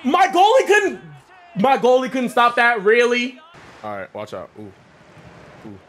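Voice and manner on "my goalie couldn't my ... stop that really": loud, high disbelief at the goalie; real gamer outrage; outraged shout. Real, not acted. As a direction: yell it at the screen. Goal conceded in soccer game.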